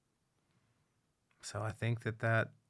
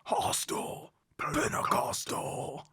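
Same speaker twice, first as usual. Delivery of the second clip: long guttural growl of a song title; death metal voice; 6 semitones higher, slower than usual. Retold or imitated. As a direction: roar the title slowly like a death metal frontman. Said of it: "death metal voice".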